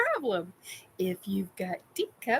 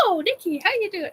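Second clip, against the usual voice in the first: higher-pitched mock surprised hello; startled greeting; 10 semitones higher, faster than usual. Trainer turns round to greet his client, startled. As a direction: act out a jumpy, cheery greeting.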